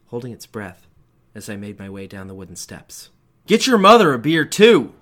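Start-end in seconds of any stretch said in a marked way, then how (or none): 3.4-4.9 loud, high bellow from the couch; dad shouting. A narrator's reading. Father shouting at his kid for more beer. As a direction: shout it across the house. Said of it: "Dad shouted from the living room".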